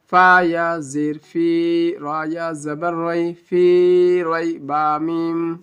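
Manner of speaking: slow, syllable-by-syllable spelling. Shown as a demonstration. Teacher spelling Quran words letter by letter, slow. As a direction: spell each letter out slowly for learners. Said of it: "چلیے ہجے کرتے ہیں".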